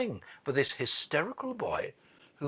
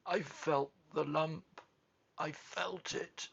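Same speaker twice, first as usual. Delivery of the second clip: slow, softening, higher choked voice; 4 semitones higher, 5 dB quieter, slower than usual. A narrator's reading. Sobbing boy insisting to Mary, choked. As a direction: squeeze the words out through tears. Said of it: "choked out Colin".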